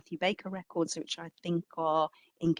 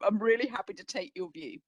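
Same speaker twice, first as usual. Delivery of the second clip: normal talking voice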